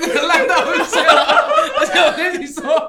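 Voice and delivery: speaking through laughter; high laughing voice. Real, not acted. Laughing at bad joke.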